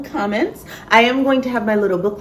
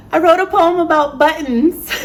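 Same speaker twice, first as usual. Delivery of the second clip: high-pitched reveal on the edge of laughter; amused reveal; 9 semitones higher, 4 dB louder than usual. Real, not acted. Telling viewers what the poem was about, amused and high-pitched. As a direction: announce it high, tickled by your own topic.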